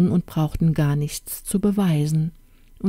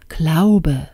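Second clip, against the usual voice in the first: pondering king; 4 dB louder than usual. Acted. King mulling over the prince's name, pondering. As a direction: repeat the name to yourself, falling.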